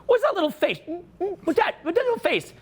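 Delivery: high teasing singsong; teasing baby talk. Acted. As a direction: tease him like a toddler caught giggling. Teasing a grinning participant.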